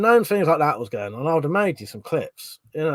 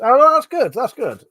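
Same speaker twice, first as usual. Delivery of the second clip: delighted; 9 semitones higher, 7 dB louder, slower than usual. Real, not acted. Delighted at viewer compliment.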